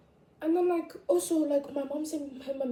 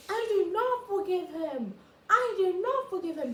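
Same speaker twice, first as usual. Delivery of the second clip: imitating others; 9 semitones higher than usual. Voicing others' stance.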